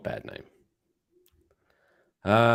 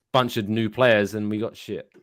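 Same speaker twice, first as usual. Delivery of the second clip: ordinary delivery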